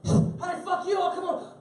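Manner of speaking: high, fast mock gangster yell; mock street tough. Tough guy yelling threats across the street, mock high yell.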